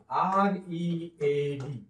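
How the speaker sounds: slow, letter by letter R E A D; spelling letters. Shown as a demonstration. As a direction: spell आर ई ए डी out slowly. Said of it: "तो read असा आहे". (आर ई ए डी हळू spelling)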